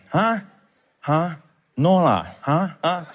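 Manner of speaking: imitating a child's Singlish interjections; short Singlish utterances, mimicking a kid; playful Singaporean English mimicry; Singlish accent. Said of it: "my son has picked up on it".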